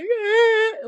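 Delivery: high, loud imitated wail; exaggerated crying noise; mock bawling. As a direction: imitate bawling with one drawn-out cry. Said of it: "bawling my eyes out".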